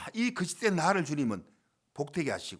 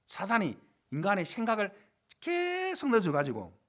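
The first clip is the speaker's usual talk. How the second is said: usual speaking voice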